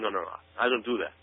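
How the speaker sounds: ordinary talk, nothing marked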